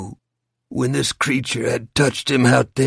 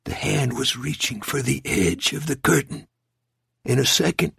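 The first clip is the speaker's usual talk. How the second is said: ordinary delivery, nothing marked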